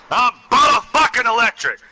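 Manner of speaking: very high, very loud yell; wild yell. Acted. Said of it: "Trying to do your laugh".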